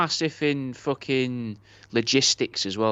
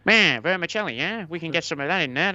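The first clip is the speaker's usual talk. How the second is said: put-on lightly done New York Italian accent, pitched higher; a hint of a New York Italian accent, pitched up; bad New York Italian accent; 6 semitones higher than usual. Friend hams a New York Italian accent mid-chat. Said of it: "begging to do an Italian accent there? A bad, a bad New York Italian accent".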